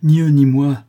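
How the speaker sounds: loud, high protest; indignant. A narrator's reading. Son defying his mother, loud indignation. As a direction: declare it with outrage. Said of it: "indigné".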